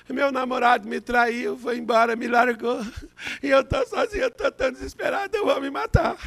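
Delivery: lightly voiced tearful girl, pitch rising toward the end; a hint of the despairing young woman; distraught girl. Pastor imitating the heartbroken girl, lightly tearful.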